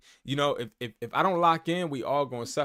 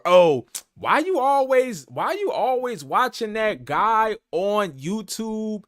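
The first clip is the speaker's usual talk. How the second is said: higher, louder and slow, whiny nagging partner voice; mock nagging partner; 7 semitones higher, 8 dB louder, slower than usual. Partner nagging about watching a YouTube guy, whiny mock voice. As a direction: drag out the complaint like a nagging partner.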